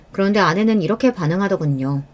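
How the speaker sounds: ordinary delivery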